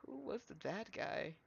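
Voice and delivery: fading to whisper. Acted. Fading into uncertainty.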